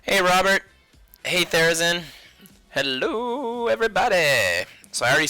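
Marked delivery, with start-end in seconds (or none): none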